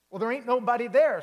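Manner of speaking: loud, high, fast cowboy protest; Cowboy insisting nobody is at the door, loud and fast; exasperated cowboy. Acted. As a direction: blurt it out in a loud, high rush. Cowboy baffled at empty door.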